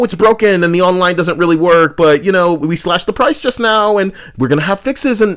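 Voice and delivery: high, loud sarcastic salesman voice; fawning corporate pitch, hammed up; mocking studio marketing voice.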